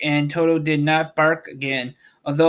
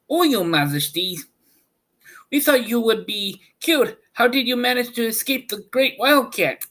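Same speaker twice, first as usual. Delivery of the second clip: exclaiming mice; 10 semitones higher than usual. A narrator's reading. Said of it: "they exclaimed".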